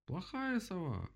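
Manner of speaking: high, drawn-out scolding; jeering. A narrator's reading. Animals scold the accused owl, jeering. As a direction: squeal it slowly at the culprit.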